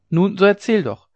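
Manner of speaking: high, loud urging; impatient call. A narrator's reading. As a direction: press her eagerly to tell. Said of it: "riefen die Dirnen wieder".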